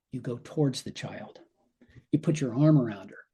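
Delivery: usual speaking voice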